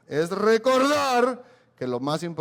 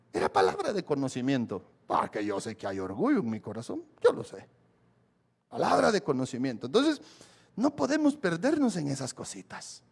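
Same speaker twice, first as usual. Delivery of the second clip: ordinary talk, nothing marked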